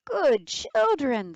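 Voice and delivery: begging. Acted. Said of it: "the wolf begged".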